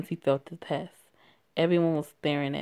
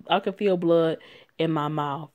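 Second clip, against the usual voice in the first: ordinary delivery, nothing marked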